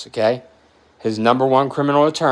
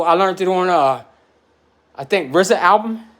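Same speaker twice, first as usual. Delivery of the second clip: ordinary talk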